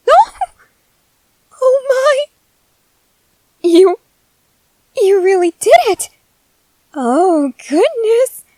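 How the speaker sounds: ecstatic. Acted. Titan finally says the word.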